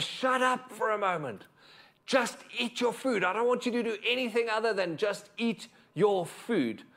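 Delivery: high-pitched, frustrated scolding; acted outburst from an exhausted, angry parent; exasperated parental shout. Worn-out parent shouts at a toddler in the high chair, exasperated. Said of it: "shouts at that toddler".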